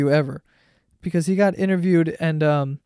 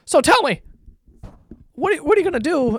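much higher, rushed reporter question; eager reporter; 10 semitones higher, faster than usual. Retold or imitated. Reporter sprinting beside the cuffed kid, rushed and high. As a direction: chase him down with a quick, high question.

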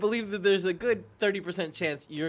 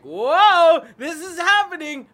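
loud, high burst of amazement; retold excitement; 7 semitones higher, 8 dB louder, slower than usual. Guest recalling the invite to see Cats, loud and excited. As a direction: exclaim it like big news just landed.

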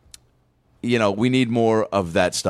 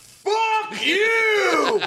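mock yell. Mock insult to Rodgers.

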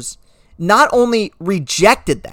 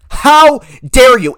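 indignant; 4 semitones higher, 5 dB louder, slower than usual. Real, not acted. Ranting at owners.

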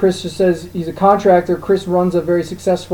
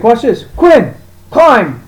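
higher, louder command to the dog; calling the dog; 5 semitones higher, 6 dB louder, much slower than usual. Retold or imitated. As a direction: call the dog up the ladder.

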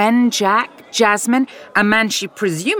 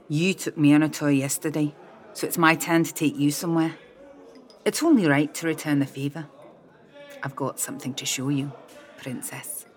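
intimate invitation; 6 dB quieter than usual. A narrator's reading. Mac's intimate invitation, fading softer.

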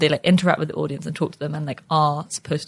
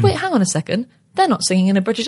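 higher-pitched audience double take; taken aback; 6 semitones higher, 3 dB louder than usual. Acted. Audiences see the show and notice the accents, taken aback. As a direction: balk as a puzzled theatregoer would. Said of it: "audiences probably come and see it and they go".

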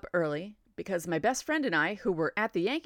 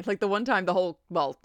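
usual speaking voice

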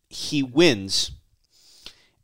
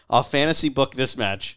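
usual speaking voice

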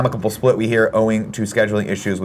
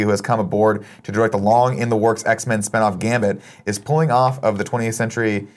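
ordinary delivery

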